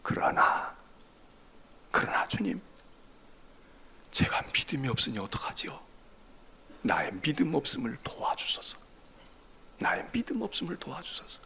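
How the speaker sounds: soft, hushed prayer of doubt; whispered plea. Acted. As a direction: breathe it as a confession. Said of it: "접속사 하나를 넣어요". (속삭이는 간절한 기도)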